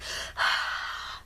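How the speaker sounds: a long open-mouthed unvoiced huff onto the stamp; huffing warm breath. Shown as a demonstration. Crafter breathes on the inked stamp to moisten it, a long breathy huff. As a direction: fog the rubber with one slow breath. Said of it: "breathe on it".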